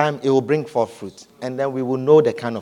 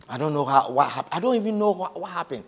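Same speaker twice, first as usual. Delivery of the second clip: ordinary talk, nothing marked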